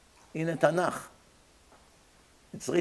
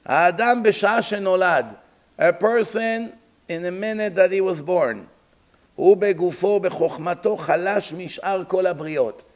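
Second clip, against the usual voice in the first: loud, raised voice reading scripture-style Hebrew; quick, emphatic recitation of a religious text; sermon text recitation; 2 semitones higher, 6 dB louder, faster than usual. Acted. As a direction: declaim the text to the congregation, then translate. Reads Hebrew text aloud and translates.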